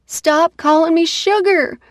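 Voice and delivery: high, loud angry scream. Acted. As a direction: scream it in frustration. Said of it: "I scream at him yet again".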